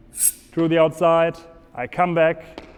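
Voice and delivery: ordinary talk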